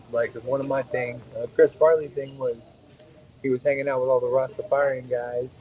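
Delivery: ordinary talk, nothing marked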